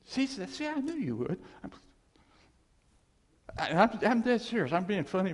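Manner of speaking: high, loud, pressing; teasing emphatic. Acted. Preacher voicing God's teasing and insisting he's serious, high and loud. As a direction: push it home, half joking.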